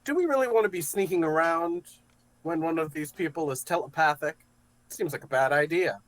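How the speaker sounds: worried character voice. Acted. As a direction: voice the doubt up high and loud.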